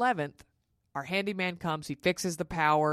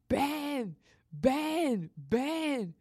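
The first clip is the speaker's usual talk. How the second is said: mock scream; 10 semitones higher, much slower than usual. Woman calls her husband as her water breaks, mock scream. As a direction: imitate calling the name out, high and stretched. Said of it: "And I start screaming".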